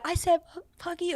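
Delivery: high, very soft, faltering defiance; quiet retold swearing. Friend repeating the insult when challenged, small and quiet. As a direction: repeat it hesitantly in a tiny voice.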